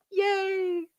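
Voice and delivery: high squeaky cheer; a little delighted victory squeal. Real, not acted. Celebrating reaching likes goal.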